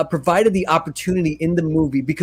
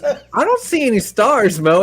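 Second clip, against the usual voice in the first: higher, put-on Vinny voice, a touch mocking; quoting Vinny; 6 semitones higher than usual. Lying in the mud with Mona Lisa, mildly voiced complaint. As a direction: grumble back at her in a lightly voiced Vinny. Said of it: "And he goes".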